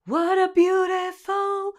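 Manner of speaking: slow sung phrase, airy breath on "what"; breathy H onset. Shown as a demonstration. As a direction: let air leak before "what". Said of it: "If we were to add that H in there".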